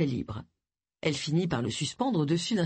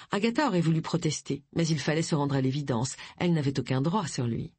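usual speaking voice

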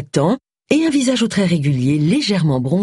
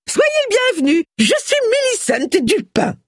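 much higher, bright announcing call; trumpeting. A narrator's reading. Millicent pushes in to greet the bishop, trumpeting. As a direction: blare her own name at the bishop. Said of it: "en claironnant".

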